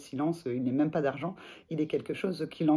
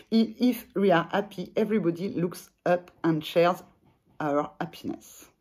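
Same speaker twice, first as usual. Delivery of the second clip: French-accented English; 2 semitones higher, 3 dB louder than usual. Real, not acted. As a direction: carefully read the English line in a French accent. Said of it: "vous excuserez mon, mon accent".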